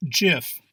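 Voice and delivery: gif said "jif", with a soft G. Shown as a demonstration. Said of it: "Proper pronunciation is gif".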